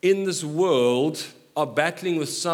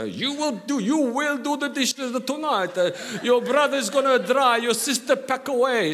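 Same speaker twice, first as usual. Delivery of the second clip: high, raised voice of a parent giving orders; stern parent; 9 semitones higher than usual. Retold or imitated. Preacher imitating his father assigning dishes, high and firm. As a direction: bark out the chore list like a dad.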